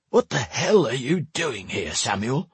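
put-on high, indignant old man's demand; put-on old man's voice, cross and high. A narrator's reading. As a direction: demand an explanation, without shouting. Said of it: "he demanded".

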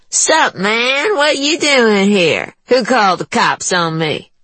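high, cocky, sneering woman; brash woman voice. A narrator's reading. Juanita greets Chi.

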